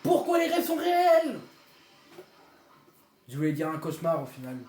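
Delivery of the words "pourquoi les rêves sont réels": high, slow shout of disbelief; disbelieving yell. Real, not acted. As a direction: cry out in disbelief. Sees two bosses at once. (cri d'incrédulité)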